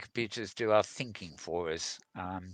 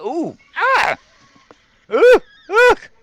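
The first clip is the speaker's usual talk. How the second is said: hammed-up screaming terror, much higher and louder; mock panic; 11 dB louder than usual. Host hamming up terror at a Godzilla VR clip, mock screaming. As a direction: shriek for help like a monster is coming. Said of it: "that was some acting there".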